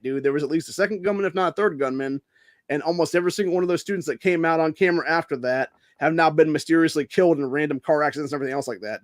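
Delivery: normal talking voice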